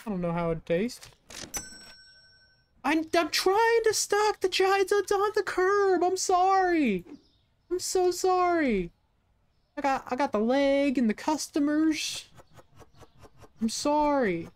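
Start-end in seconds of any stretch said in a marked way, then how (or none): 2.8-8.9 high, loud, drawn-out whine; hammed-up wailing apology. Hammed-up apology while struggling to stock. 9.8-14.5 high-pitched mock-pleading whine; hammed-up apology. Hammed-up apology to waiting customers.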